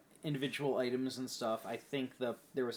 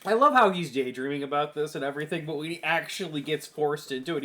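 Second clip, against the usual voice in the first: ordinary talk, nothing marked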